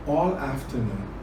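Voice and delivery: usual speaking voice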